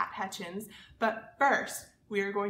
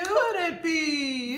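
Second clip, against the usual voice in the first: puppet voice; 5 semitones higher, 4 dB louder, slower than usual. Pretend. Puppet answers in rhyme.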